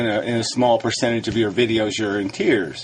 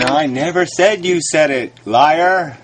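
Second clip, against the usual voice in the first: higher and louder, snapping back the denial; angry retort; 6 semitones higher, 4 dB louder than usual. Real, not acted. Debater repeating his denial to his opponent, now angry and louder. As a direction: throw it back hard, fed up with being misquoted.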